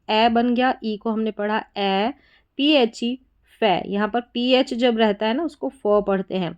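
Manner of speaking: normal talking voice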